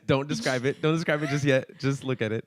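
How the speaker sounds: laughing while pleading; giggling through the words. Real, not acted. Laughing, urging friend to wait.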